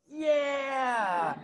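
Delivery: put-on high, bright kid's yes; excited kid. A curious kid answering the amputee excitedly. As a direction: squeal it like a thrilled child. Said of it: "And they're like".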